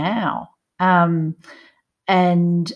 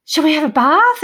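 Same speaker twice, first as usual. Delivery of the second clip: higher, louder bright invitation; cheerful call; 9 semitones higher, 6 dB louder than usual. Retold or imitated. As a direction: call the kids to the bath happily. Mother calling children.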